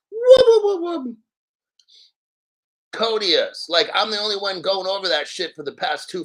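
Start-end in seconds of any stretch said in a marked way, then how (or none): 0.1-1.2 high, loud siren-like whoops; playful whoop-whoop siren; mock siren whoop. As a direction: blare it like an alarm. Mock alarm celebrating.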